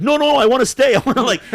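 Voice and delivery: loud, high pleading to remain; excited mock protest. Young patron refuses to leave bar brawl, loud excited protest. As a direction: protest eagerly, re-enacting the moment.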